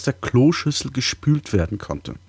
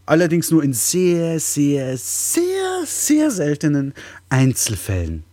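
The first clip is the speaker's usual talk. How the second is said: sehr rising to a high squeak; stressed sehr; 4 semitones higher than usual. Acted. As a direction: stretch each sehr. Emphatic repeated sehr.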